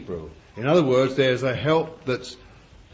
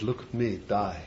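soft, quiet words of Jesus; Jesus, softly; 3 semitones lower, 10 dB quieter than usual. Acted. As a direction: say it gently and humbly, softer. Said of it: "Jesus said".

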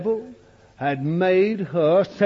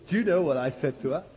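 usual speaking voice

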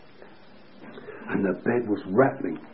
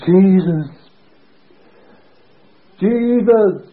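loud, raised cry erupting; retold shout; 9 semitones higher, 10 dB louder, much slower than usual. Preacher erupting at a patient's bedside, retold shout. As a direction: shout it out across a whole ward. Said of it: "I suppose the whole ward heard".

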